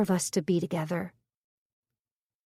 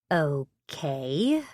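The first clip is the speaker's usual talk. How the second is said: said slowly; 2 semitones lower than usual. A narrator's reading. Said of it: "I say slowly".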